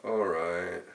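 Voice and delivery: a drowsy, lazy grunted reply; grunted from bed, barely awake; sleepy grunt. A narrator's reading. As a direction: mumble it half asleep into the pillow. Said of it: "they grunt back".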